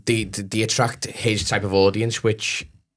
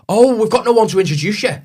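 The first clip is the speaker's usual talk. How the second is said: high, louder whiny staff voice; mock venue staff; 9 semitones higher, 6 dB louder than usual. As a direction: mimic the clueless bar staff, pitched up high. Mocking unhelpful venue staff.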